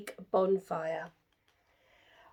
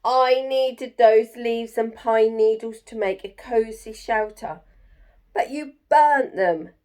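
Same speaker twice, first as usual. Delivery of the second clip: loud, cross complaint; snapping porcupine; 3 semitones higher, 7 dB louder than usual. Acted. Angry porcupine scolds Jim for burning its leaves, loud and cross. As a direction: tell him off sharply. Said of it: "snapped the porcupine".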